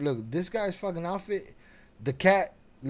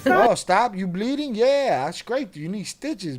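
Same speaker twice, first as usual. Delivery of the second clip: higher, louder mocking taunt; mock cat taunt; 5 semitones higher, 7 dB louder than usual. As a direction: tease her like the smug cat. Mocking the bleeding owner.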